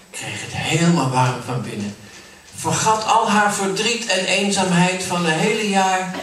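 plain reading voice

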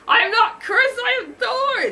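high, loud yelled correction; indignant yell. A narrator's reading. Thor correcting an adoring fan, indignant yell. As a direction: yell it out, pitch way up. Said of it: "Thor yelled".

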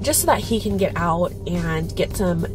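ordinary delivery

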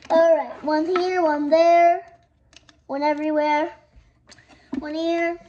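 loud, bouncy counting off; loud announcing. Acted. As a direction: call each spot out loud. Setting out dolls, loud.

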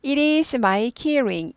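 very slow, clear model reading; slow demo. Shown as a demonstration. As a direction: read it out slowly for learners. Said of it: "slow model sentence".

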